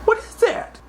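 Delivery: high, puzzled mother's voice; high mock mom asking; curious mom. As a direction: voice the suspicious mom.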